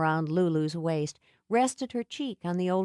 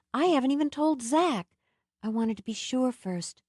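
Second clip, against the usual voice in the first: put-on higher, breathless young woman; 7 semitones higher than usual. A narrator's reading. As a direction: share secret news with nervous hope. Nell voiced, pregnancy news.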